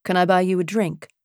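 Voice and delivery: put-on quick British-accented offer; lightly played British man, brisk and flirting. A narrator's reading. Man offers the woman at the bar a drink, British accent. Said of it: "that lovely British accent".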